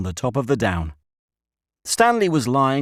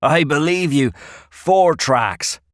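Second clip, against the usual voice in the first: loud, higher rustic voice; old country shepherd; 7 semitones higher, 5 dB louder, slower than usual. A narrator's reading. Shepherd telling the boys nobody uses the well, emphatic rustic. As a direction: tell them firmly in country speech.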